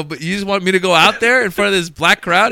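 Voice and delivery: high, loud disbelief; can't believe what you're being asked; comic asking booker in loud disbelief; incredulous. Retold or imitated.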